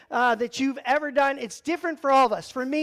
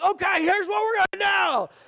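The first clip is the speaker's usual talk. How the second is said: dive instructor; 7 semitones higher than usual. Retold or imitated. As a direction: call out instructions like the guide on the boat. Dive guide briefing.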